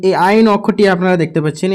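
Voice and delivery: normal talking voice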